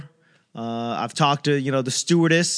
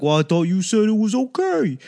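higher-pitched mock husband protest; 7 semitones higher than usual. Husband defending himself to his wife, higher-pitched protest.

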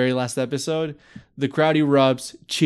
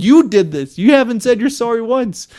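louder, higher, accusing the cheater; angry accusation; 9 semitones higher, 6 dB louder than usual. Retold or imitated. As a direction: throw it in his face, higher and louder. She confronts cheating fiancé.

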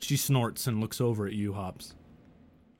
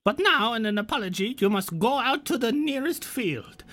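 much higher, grand and haughty; high mock-royal decree; haughty demand; 11 semitones higher than usual. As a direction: lord it over him with a lofty command. GM voices a character demanding penance.

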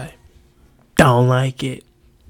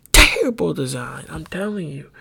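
ordinary talk

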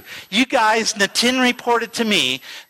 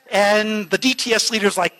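ordinary delivery

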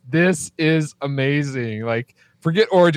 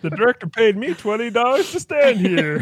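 mock extra voice; 7 semitones higher than usual. Voicing the robot extra.

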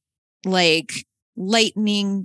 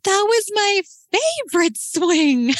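put-on high, whiny kid voice, a lot louder; disappointed little kid; 13 semitones higher, 4 dB louder than usual. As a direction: play a let-down four-year-old mourning his swing. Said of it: "George is so disappointed".

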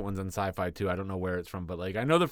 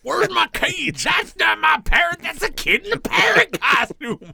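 high, loud slow shout; angry mother shout; 9 dB louder than usual. Pretend. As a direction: yell like a mom who got scammed. Outraged mother shouting again.

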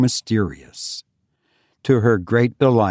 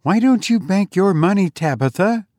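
higher, gently pleading sister; timid remonstrance; 9 semitones higher than usual. A narrator's reading. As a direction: coax her sister to bank the money. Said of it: "Eunice ventured to remonstrate".

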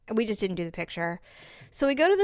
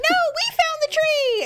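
much higher mock shout across a field; calling back; 4 dB louder than usual. Child calling back to the parent. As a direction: yell back insisting.

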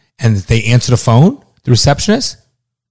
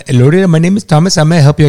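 higher, louder mock phone agent; call-center agent impression; 5 semitones higher, 6 dB louder than usual. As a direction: imitate an over-eager call-center greeting. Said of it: "when I call Amex and I get somebody on the phone".

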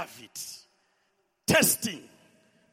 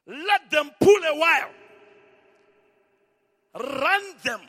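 shouted quote; 6 semitones higher, 10 dB louder than usual. Acted. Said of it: "He says".

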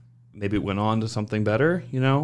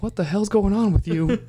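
higher, a touch louder wife voice; imitating wife; 10 semitones higher, 4 dB louder than usual. Wife hearing the podcast, incredulous higher voice. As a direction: play the baffled wife, pitched up. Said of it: "my wife's gonna hear this and be like".

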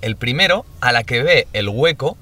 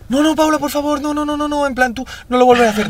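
begging my sister in a squeaky voice; imitating pleading little self. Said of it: "Yo al principio caía y decía". (súplica aguda de niña)

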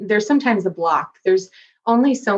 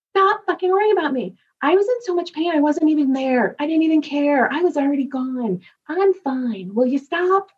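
much higher, exasperated dad voice; imitating dad; 9 semitones higher than usual. Dead father scolding his grieving daughter, exasperated. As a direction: scold her like a fed-up father, pitched up. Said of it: "he was like".

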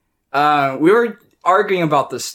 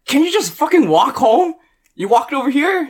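high-pitched, incredulous retort; exasperated refusal; 11 semitones higher than usual. Retold or imitated. Answering the ex who wants an Uber home, exasperated. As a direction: push back, voice raised high in disbelief. Refusing ex's request for a ride.

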